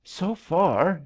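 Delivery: high, distressed voice; choked out. A narrator's reading. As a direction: struggle to get it out while going under. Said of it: "she choked".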